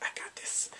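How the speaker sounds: low-volume, half-whispered quote; hushed imitation. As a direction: murmur it in imitation, quietly confident. Retells cook taking charge quietly.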